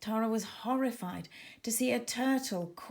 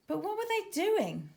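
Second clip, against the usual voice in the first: puzzled wonder; 11 semitones higher than usual. A narrator's reading. Narrator wonders about dolphins.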